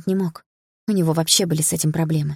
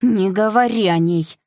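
higher, louder, slowed cry; shouting; 4 semitones higher, 6 dB louder, slower than usual. Acted. Sister shouting at brother not to mention mom. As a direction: forbid him sharply.

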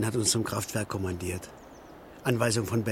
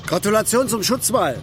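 loud, higher sarcastic shout; bitter shout; 8 semitones higher, 7 dB louder than usual. Acted. Worker shouting sarcastic congratulations about the wall. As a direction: fling the congratulations in their face.

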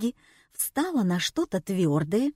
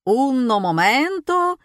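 loud, higher, slow showman's cry; squealing; 5 semitones higher, 6 dB louder than usual. A narrator's reading. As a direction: shriek it out to the crowd. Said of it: "визжал фокусник".

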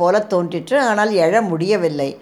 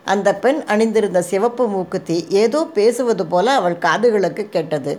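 ordinary delivery

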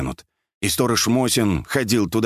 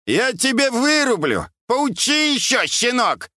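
put-on high, scolding old man's shout; high-pitched irate hollering; angry yelling. A narrator's reading. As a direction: bawl threats at a youngster. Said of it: "Тот снова разорался".

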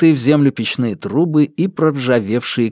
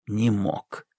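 ordinary delivery, nothing marked

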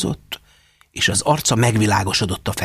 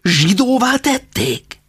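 higher, slow aghast question; aghast father; 12 semitones higher, 4 dB louder, slower than usual. A narrator's reading. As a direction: drag it out in horror. Father's rising outrage. (megdöbbent, lassú kérdés)

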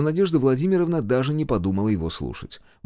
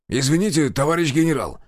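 raised-pitch, formal officer's reply; firm objection; 5 semitones higher than usual. A narrator's reading. Major answers the general unabashed, firm. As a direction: object without backing down.

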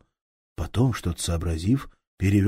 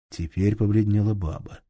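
ordinary delivery

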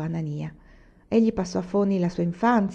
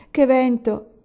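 louder, higher wail on "che vento!"; lament rising; 5 semitones higher, 6 dB louder, slower than usual. A narrator's reading. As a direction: cry out "che vento!" in complaint. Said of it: "si lamentò poi Olì". ("che vento!" gridato più forte e più acuto)

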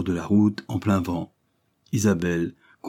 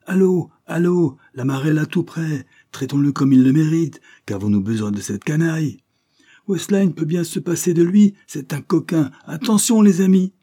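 much higher, louder rowdy crowd cries; mob shouting; 8 semitones higher, 5 dB louder than usual. A narrator's reading. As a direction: rouse the mob, yelling. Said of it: "Et mille voix le répétèrent".

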